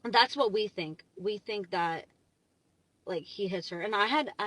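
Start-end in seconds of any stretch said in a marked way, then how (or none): none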